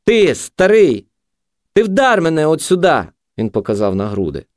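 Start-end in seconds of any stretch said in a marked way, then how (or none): 0.0-3.0 high goading yell; high-pitched shouted challenge. A narrator's reading. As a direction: dare him in a raised voice. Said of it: "крикнув наркоман".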